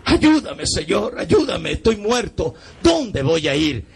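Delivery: high cry slowing on the last question; acted anguish, leaping up in pitch; retold anguished plea. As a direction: beg God for help in a reenactment. Reenacts begging God when dead.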